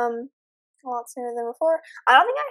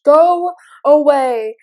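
drawn-out, much louder cry of go away; loud slow plea; 3 semitones higher, 14 dB louder, much slower than usual. Real, not acted. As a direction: yell it at the thing in the doorway. Animatronic at the door, panicking.

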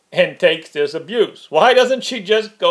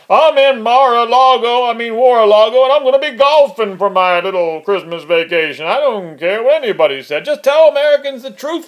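loud, higher-pitched mock confession; mock Trump; 6 semitones higher, 6 dB louder than usual. Host showing the president how to confess, loud and brazen. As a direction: blare it out as a brazen admission. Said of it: "just tell us the truth".

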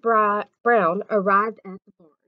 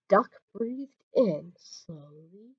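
ordinary delivery, nothing marked

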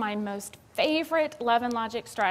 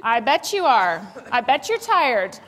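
loud, slow sarcastic jab; loud, slow mocking "I bet you're tired"; 2 semitones higher, 8 dB louder than usual. Shown as a demonstration. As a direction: drag it out mockingly. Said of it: "that's sarcasm".

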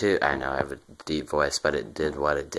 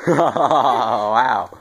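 loud high laugh into an oh wow; helium laugh; 6 semitones higher, 11 dB louder than usual. Real, not acted. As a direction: burst out laughing at your own squeak.

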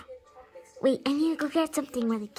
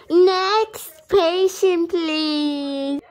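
high, slow loud call; 7 semitones higher, 6 dB louder, much slower than usual. Pretend. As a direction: call the next patient in. Calling out to waiting room.

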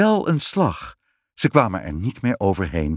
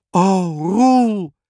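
high, loud cry of astonishment; astonished queen; amazed; 8 semitones higher, 5 dB louder than usual. A narrator's reading. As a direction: exclaim in delighted wonder. Queen amazed at changed husband.

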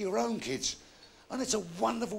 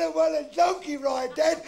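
put-on high, louder pestering kid; demanding child; 8 semitones higher, 5 dB louder than usual. Boy begging dad for donkey ride, loudly insistent.

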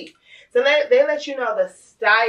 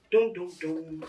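mock drumroll; 6 semitones lower, 7 dB quieter than usual. Suspense sound while cutting.

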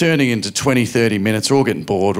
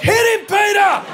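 high, shrieking cheer; mum egging on. Retold or imitated. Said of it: "my mum would be like".

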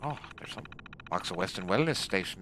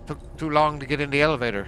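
yelling; 10 semitones higher, 12 dB louder than usual. Real, not acted. Complaining at teammates.

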